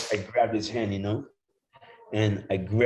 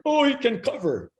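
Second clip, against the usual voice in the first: much higher, lightly voiced impressed coach; mock coach; 4 dB louder than usual. Coaches noticing he can cover, mock impressed. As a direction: play the surprised coaches.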